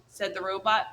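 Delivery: ordinary delivery, nothing marked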